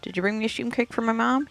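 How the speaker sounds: put-on a small boy asking eagerly; child voice. Little Bub voiced by player.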